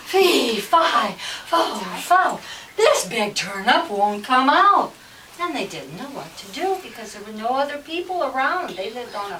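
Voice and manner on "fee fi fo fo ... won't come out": slow, heavy rhythmic chant; loud story refrain. Acted. Farmer stuck with the turnip, loud slow chant. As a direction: pound out each word loudly, drawing it out.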